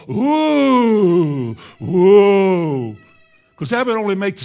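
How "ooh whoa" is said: loud, drawn-out mock swooning; mock awed crowd. Preacher mimicking a congregation wowed by refined speech, mock awe. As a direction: draw it out loudly like an over-impressed audience. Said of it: "so you'll go".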